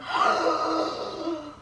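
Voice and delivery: big drawn-in gasp before a sneeze; exaggerated pre-sneeze inhale; sneeze wind-up. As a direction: suck in a huge storybook breath. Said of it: "'cause he's going".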